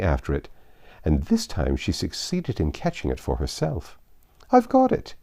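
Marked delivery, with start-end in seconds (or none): 4.5-5.1 high, loud triumphant cry. Acted. Queen catching her shawl, triumphant cry. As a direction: crow over the catch. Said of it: "in a triumphant tone".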